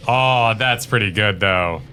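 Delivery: usual speaking voice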